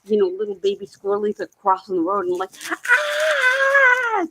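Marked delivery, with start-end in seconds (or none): 2.7-4.3 very high yelp at the squirrels; yelling. Retold or imitated. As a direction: shout up high to stop the traffic. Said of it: "yelling, screaming".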